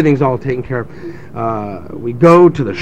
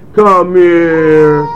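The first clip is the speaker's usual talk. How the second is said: mock stern parent; 2 semitones higher, 6 dB louder than usual. Mock stern parent calls child.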